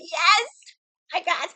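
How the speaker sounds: a quick mock triumphant yes; excited. Retold joy at finding song.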